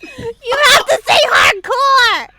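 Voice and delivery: shouting through laughter; laughing. Real, not acted. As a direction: laugh it out loud. Laughing instruction.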